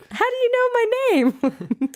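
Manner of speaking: high-pitched joking voice; squeaky high mock reply. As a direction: jump way up high in mock surprise. Playing Dick, joking reply.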